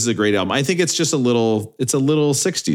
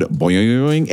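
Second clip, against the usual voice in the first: bouncy spoken boing; cartoon spring noise; boing sound effect. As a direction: go up and down on each "boing". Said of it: "That's the sound effect".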